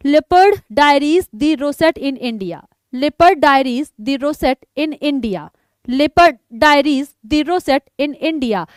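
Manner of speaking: each word drawn out slowly for the class; slow repeat-after-me drill. Shown as a demonstration. Teacher drilling an online class on a book title, slow and deliberate. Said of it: "तो repeat कर लेते हैं इस किताब को".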